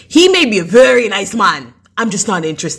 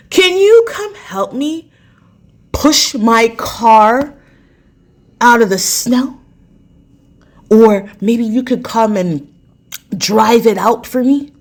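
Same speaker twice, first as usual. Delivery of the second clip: slow, high pleading voice; hammed-up pleading neighbor; imitating neighbor; 4 semitones higher, 3 dB louder, slower than usual. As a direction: stretch it out, mock-helpless. Voicing neighbor's plea.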